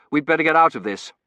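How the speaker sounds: urgent. A narrator's reading. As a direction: urge her away from the bombs. Urges her to shelter from bombs.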